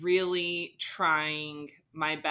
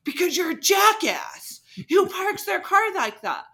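loud, high-pitched retold outburst; imitated angry screaming; 12 semitones higher, 7 dB louder than usual. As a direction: mimic an irate driver yelling, pitch shooting up. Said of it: "Why are you screaming at me then?".

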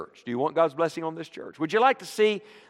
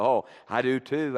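ordinary talk

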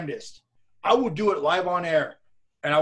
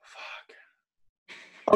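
whispered curse; 9 dB quieter than usual. Real, not acted. Muttering while trying to remember.

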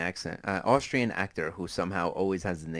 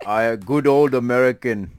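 loud, mock Austrian accent; Schwarzenegger accent; 3 semitones higher, 11 dB louder than usual. Podcaster playing the actor as all-American, loud Austrian accent. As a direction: boast in a thick fake Austrian accent. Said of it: "a fucking Schwarzenegger accent".

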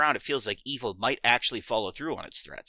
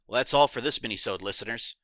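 usual speaking voice

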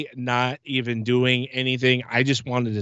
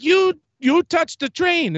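much higher, slower put-on voice of a stern ride attendant; imitating the lady; 13 semitones higher, slower than usual. Recounting how the attendant at the ride booth called him back, mimicked high and slow. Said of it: "This is how she sounded".

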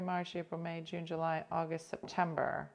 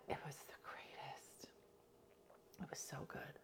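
hushed, reverent whisper; barely there, all breath; awed whisper; 3 semitones lower, 21 dB quieter than usual. Real, not acted. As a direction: whisper it, savoring the memory. Savoring a remembered favorite dish.

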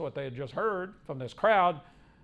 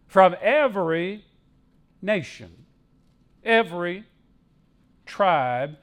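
slow list with a pause after each item; deliberate, emphatic pulpit reading; scripture proclamation; 2 semitones higher, 8 dB louder than usual. Acted. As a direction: punch each 'every', then let it settle. Preacher reads scripture aloud.

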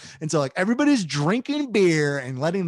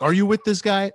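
higher, louder pointed question; a mock-stern ranger singling him out; suspicious ranger; 6 semitones higher, 4 dB louder than usual. Ranger questioning the friend, higher and pointed. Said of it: "he's like".

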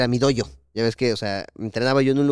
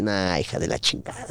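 lower, softer exasperated curse; annoyed mutter; 6 semitones lower, 5 dB quieter than usual. Retold or imitated. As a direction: grumble it under your breath. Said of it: "y yo".

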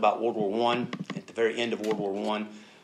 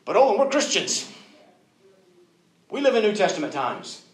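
higher, louder mock indignation; protesting objection; 8 semitones higher, 8 dB louder than usual. Believer objecting to the preacher, loud mock protest. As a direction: push back as an offended objector.

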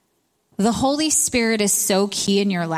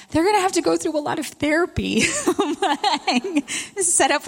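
comic despair; 8 semitones higher than usual. Retold or imitated. Joking despair about her kids.

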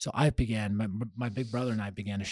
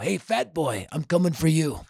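higher, louder mock tough-guy taunt; taunting threat; 6 semitones higher, 6 dB louder than usual. Uncle taunting Alzado, higher louder mock threat. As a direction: sneer it out like a bully calling someone out.

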